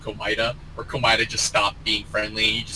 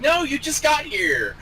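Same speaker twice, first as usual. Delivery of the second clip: pitched-up whiny protest; high protest; 14 semitones higher than usual. Retold or imitated. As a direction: whine it high. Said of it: "You're like".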